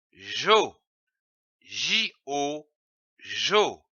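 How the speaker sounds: slow teacher syllable drill, letters spelled between; syllable sounded out. Shown as a demonstration. Teacher sounding out ja for a child. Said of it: "Je lis une syllabe".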